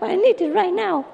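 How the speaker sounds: very high, squawking duck voice; a frantic duck crying for help; shrill quacking plea; panicked duck. Acted.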